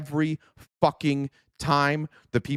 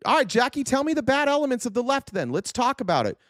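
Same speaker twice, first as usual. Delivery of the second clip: sarcastic challenge; 9 semitones higher than usual. Real, not acted.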